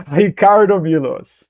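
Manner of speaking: Ricardo starting on a throaty H; deep-throated Brazilian R. Shown as a demonstration. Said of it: "very deep on your throat".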